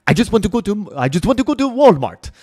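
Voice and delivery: put-on a clumsy take on the boxing coach's accent; hammed-up imitation of a coach begging for Walmart; bad accent imitation. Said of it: "he would always be like".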